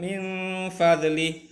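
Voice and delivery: Min fadlih with the n blurred into a hum; hidden nun. Shown as a demonstration. Teacher showing pupils how to hide the nun. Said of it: "tapi harus disamarkan".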